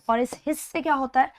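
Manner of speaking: ordinary talk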